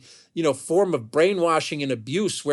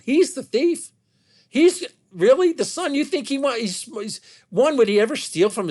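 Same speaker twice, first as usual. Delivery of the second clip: incredulous; 8 semitones higher than usual. Real, not acted. Scoffs at accusation against the son.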